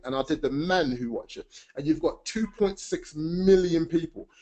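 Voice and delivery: playful imitation of an English accent; American speaker doing an English accent; put-on English accent. Shown as a demonstration. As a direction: switch into an English accent and keep presenting. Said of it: "I can do an English accent too".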